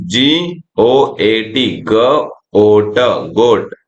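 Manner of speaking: put-on teacher sounding out for kids; slow letter spelling. Shown as a demonstration. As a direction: spell out each letter very slowly. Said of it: "इस तरीके से". (बहुत धीरे अक्षर-अक्षर बोलना)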